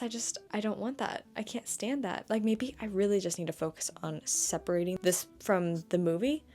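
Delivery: normal talking voice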